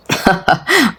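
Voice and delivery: short acted laugh. Brother laughs at being told to cancel, amused. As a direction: laugh off the threat.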